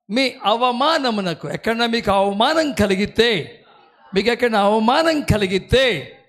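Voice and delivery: loud, rising preacher's exhortation; preaching at full force. Acted. Preacher to his congregation about double honour, at full force. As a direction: fire up the congregation, voice climbing.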